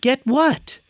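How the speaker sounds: high, puzzled, innocent little question; naive Frosty voice. Retold or imitated. Phineas baffled by Candace's threat, high and innocent. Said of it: "as if he is Frosty the Snowman".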